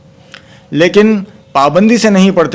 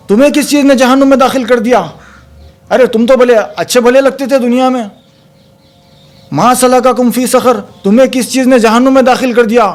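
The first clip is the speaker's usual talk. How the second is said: a higher, faster voice, asking in disbelief; हैरान जन्नती का सवाल; 5 semitones higher than usual. Acted. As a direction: ask the friend in hell, shocked. जन्नती जहन्नुमी से पूछता है. (ऊँची तेज़ आवाज़ में हैरानी भरा सवाल)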